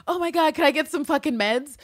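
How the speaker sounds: lightly hammed-up frustration; mock Villanelle. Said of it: "she's like".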